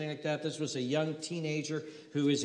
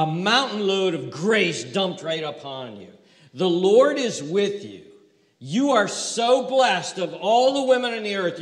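joyful angel; 3 semitones higher, 7 dB louder, slower than usual. Acted. Preacher voicing angel's joyful greeting.

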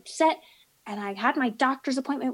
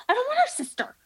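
put-on much higher, childish whine on the word her; high whiny protest; 11 semitones higher, faster than usual. Insisting to the breeder she only wants Pickle, whining high. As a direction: pitch it way up like a sulking kid. Retelling refusal of the puppy's sister.

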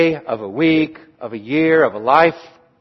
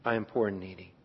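I am poor and needy, dropped much softer and lower; humble hush; 7 semitones lower, 14 dB quieter, faster than usual. Shown as a demonstration. King admitting need before God, humble hushed confession. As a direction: confess it under your breath. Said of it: "before God, it is no question".

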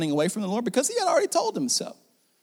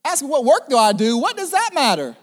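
defensive Jonah; 5 semitones higher than usual. Acted. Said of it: "he's probably bucking up".

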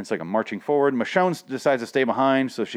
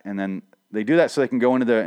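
ordinary talk, nothing marked

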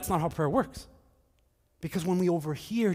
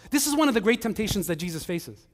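ordinary talk

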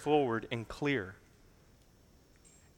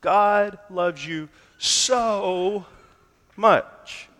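drawn-out, raised "so ... much"; stress on SO MUCH; 4 semitones higher, much slower than usual. Shown as a demonstration. Preacher showing a sentimental reading of the verse, weight on "so much". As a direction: stretch "so" very slowly, pause, then land "much" high. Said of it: "such a reading puts the weight of the verse on how much".

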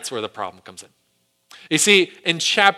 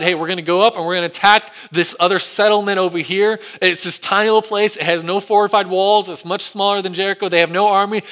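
upbeat, higher, louder planning voice; cocky Israelites; 5 semitones higher, 6 dB louder than usual. Retold or imitated. Israelites planning an easy attack, confident. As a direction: sound sure it's a walkover. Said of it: "because they decide".

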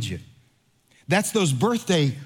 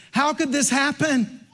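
mock distraught wail; 10 semitones higher than usual. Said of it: "He goes".

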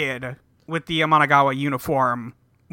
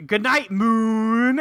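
slow, drawn-out, higher mock goodbye; villain swimming away with a theatrical sign-off; smug farewell; 5 semitones higher, much slower than usual. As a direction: savor each word like a taunting exit. Villain's parting line escaping.

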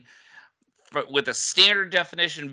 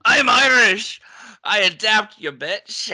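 a hammed-up, shouted Irish accent, higher and louder; bad Irish accent; 5 semitones higher, 5 dB louder than usual. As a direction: play Miles O'Brien with a terrible Irish accent. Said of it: "That was the worst Irish accent ever".

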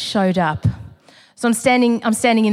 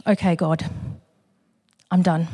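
quiet surrender; 5 semitones lower, 4 dB quieter than usual. Retold or imitated. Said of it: "And I go".

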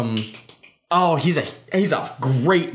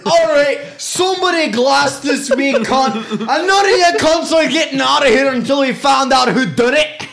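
loud, high Scottish pub shout; Scottish accent; 8 semitones higher, 6 dB louder than usual. Acted. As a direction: bellow it like an angry Scottish barman. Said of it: "That one's for you, Brody".

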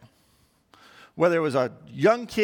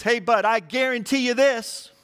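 higher, insistent "I guarantee you this"; emphatic; 4 semitones higher than usual. Retold or imitated. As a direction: imitate a friend vouching with "guarantee". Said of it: "he was so emphatic".

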